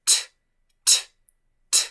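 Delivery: "t" seca y aislada, sin vocal; sonido de T. Shown as a demonstration. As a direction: soltar solo el golpe de aire de "t", con pausas. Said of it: "¿Cómo es el sonido de la T?". ("t" sin voz, repetida por separado)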